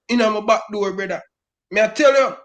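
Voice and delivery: ordinary talk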